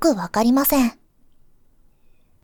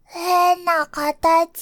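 put-on high, very slow childish remark; a toddler puzzling over an odd shape; small child's voice; 4 semitones higher, much slower than usual. Child looking at pumpkin. (高い声でゆっくり不思議がる幼児)

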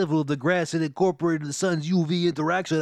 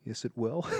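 quiet, lower repeat; soft murmur; 6 semitones lower, 10 dB quieter than usual. Acted. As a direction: drop it to a murmur. Repeated much softer.

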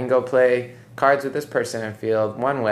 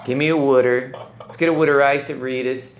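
playful put-on Philly accent, pitched higher; 5 semitones higher, 4 dB louder than usual. Shown as a demonstration. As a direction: slip into a hammed-up Philadelphia accent. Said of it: "I love the Philly accent".

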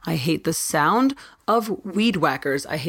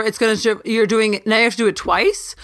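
ordinary delivery

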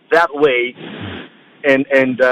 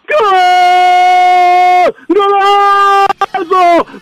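high, stretched-out shouted goal cry; long goal yell; 14 semitones higher, 6 dB louder, much slower than usual. Shown as a demonstration. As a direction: hold the goal yell as long as you can. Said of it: "recreate that Mark Stone third goal".

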